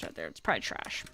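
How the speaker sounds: whispered aside; a dismissive whispered aside. Real, not acted. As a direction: breathe it under your breath. Aside about chest.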